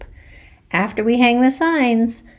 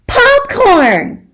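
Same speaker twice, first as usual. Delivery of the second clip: outraged cry; 7 dB louder than usual. Acted. Outraged child exclaims.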